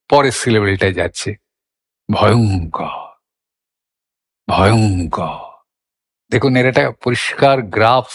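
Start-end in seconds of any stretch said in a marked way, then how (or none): 2.1-3.0 ভয়ঙ্কর low, rolling into a heavy অং; strong middle stress. Shown as a demonstration. Teacher sums up the whole pronunciation, strong middle stress. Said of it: "কঠিন ঝোঁক একটা". 4.5-5.4 ভয়ঙ্কর low, rolling into a heavy অং; strong middle stress. Shown as a demonstration. Teacher sums up the whole pronunciation, strong middle stress. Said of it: "কঠিন ঝোঁক একটা".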